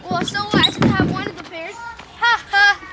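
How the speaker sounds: high, loud singsong teasing; loud taunting. Acted. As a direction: gloat like a playground bully. Snatching shoe, teasing chase.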